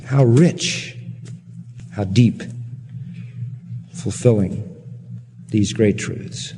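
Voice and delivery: earnest, measured prayer with long pauses; closing prayer. Real, not acted.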